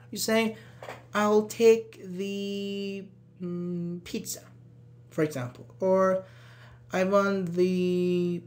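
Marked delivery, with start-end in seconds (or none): 1.1-4.4 "the" drawn out very long as "theee", then "mm"; slow, undecided hold on "the"; thinking hesitation. Shown as a demonstration. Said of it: "Because you're thinking". 6.9-8.4 "the" held long as "theee" and left hanging; slow trail on a long "the"; thinking hesitation. Shown as a demonstration. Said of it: "Because you're thinking".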